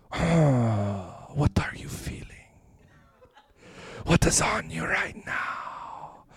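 hushed breathy preacher whisper, slow at the end; soft slow whisper; whispered question. Retold or imitated. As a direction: lean in and whisper intimately into the mic. Preacher softly asks into microphone.

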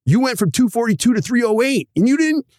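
accountant panicking over the numbers; freaking-out accountant. Retold or imitated. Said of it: "your dental accountant's freaking out".

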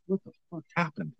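soft, fast, bewildered half-breath; walking out of the office dazed; stunned disbelief. Retold or imitated. Walking out stunned after boss's reaction.

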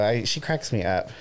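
ordinary delivery

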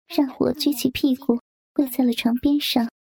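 usual speaking voice

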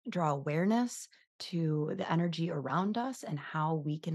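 soft, gentle prayer voice; heartfelt prayer spoken gently. Acted. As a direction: pray softly over the listeners. Leads a prayer.

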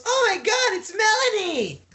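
high-pitched giggly impression of the host's awe; mock starstruck squeal. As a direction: squeal it back at her, teasing. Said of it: "He goes".

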